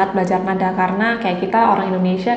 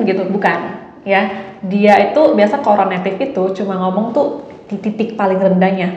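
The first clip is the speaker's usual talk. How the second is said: normal talking voice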